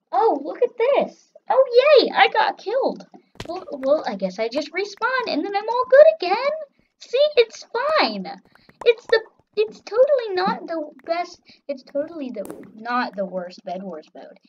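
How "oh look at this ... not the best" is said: high, loud mock delight; sarcastic cheer. Player who just got killed, sarcastic cheer. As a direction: chirp it as if thrilled, overdone. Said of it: "I was being sarcastic".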